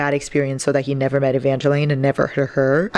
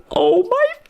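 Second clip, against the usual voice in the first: overwhelmed squeal. Real, not acted. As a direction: shriek it like it hurts in the best way. Overwhelmed reaction while reading.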